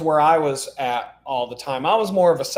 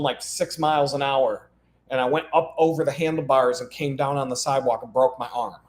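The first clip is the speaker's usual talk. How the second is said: normal talking voice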